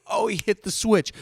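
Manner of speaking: ordinary delivery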